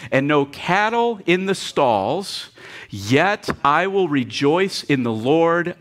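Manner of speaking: slow, loud reading aloud together; preacher leading the room through the verses; congregational reading. Real, not acted. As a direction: read it out with the whole church. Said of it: "Are you ready, everybody?".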